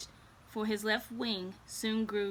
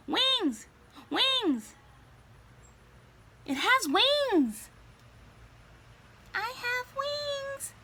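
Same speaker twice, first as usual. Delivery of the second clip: high, loud silly shout, slowing at the end; 4 dB louder, much slower than usual. A narrator's reading. Child squeezing the kitten shouts, high and silly. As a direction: squeal it like a giddy child. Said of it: "kept shouting in its silly voice".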